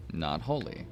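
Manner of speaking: plain reading voice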